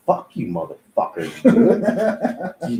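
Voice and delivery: mock angry outburst breaking into a laugh; half-laughing mock rage; retold angry outburst. Rejected apprentice snapping at the shops, mock angry and laughing. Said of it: "I was mad".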